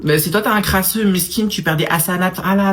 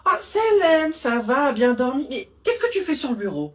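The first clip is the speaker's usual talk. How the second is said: sweet mother; 6 semitones higher, 5 dB quieter than usual. Acted. Mother greeting her child sweetly.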